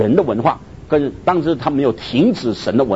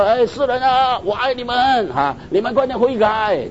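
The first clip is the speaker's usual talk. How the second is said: mock call from heaven; 9 semitones higher than usual. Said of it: "如果上帝在天上那边喊就好了嘛".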